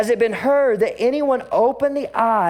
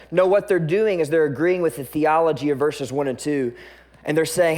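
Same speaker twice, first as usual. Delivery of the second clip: ordinary talk, nothing marked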